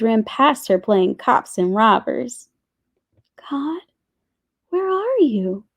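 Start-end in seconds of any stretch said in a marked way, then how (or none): 3.5-5.6 hushed first word, then high-pitched question; child's searching inner voice; soft, high and wondering; whispered thought. A narrator's reading. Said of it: "Nia whispered in her head".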